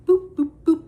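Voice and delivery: high, bright sound-effect boops; squeaky little boop boop boop; playful boops. Real, not acted. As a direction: chirp them like a cartoon transition.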